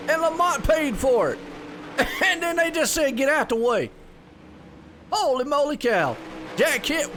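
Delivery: race play-by-play. Acted. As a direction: call the race lap by lap with energy.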